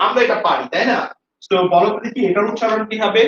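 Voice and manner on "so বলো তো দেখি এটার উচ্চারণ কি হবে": ordinary talk, nothing marked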